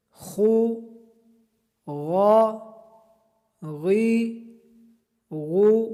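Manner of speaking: each syllable pushed from the throat, drawn out; throat letters. Shown as a demonstration. Teacher drills throat letters, sounded from the throat. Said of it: "حلق سے ادا کریں گے".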